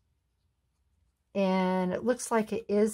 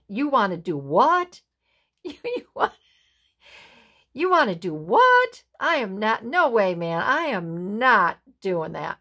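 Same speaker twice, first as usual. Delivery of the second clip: incredulous and much louder, pitch jumping up; shocked flat refusal; indignant female bird; 4 semitones higher, 6 dB louder than usual. Acted. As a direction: balk at him, outraged he'd even ask. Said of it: "she's looking at him like".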